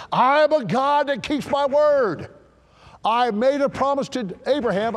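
high, loud, emphatic proclamation; thundering as God. Acted. God swearing he keeps his word, thundering loudly. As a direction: proclaim it at full preaching force. Preacher thunders God's promise to Israel.

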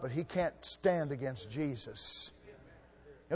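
usual speaking voice

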